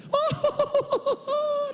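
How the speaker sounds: high operatic laugh on oh; lyrical laugh. Retold or imitated. Pastor mimicking his wife's operatic laugh, high and sung. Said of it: "ela ri assim".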